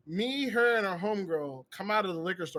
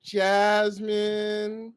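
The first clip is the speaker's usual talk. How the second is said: imitated shy girl giving her name; super shy; 4 dB louder than usual. Shy girl answers a guy in a car, super shy. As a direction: draw it out evenly, as if bashful. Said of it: "like, super shy".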